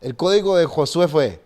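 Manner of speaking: ordinary delivery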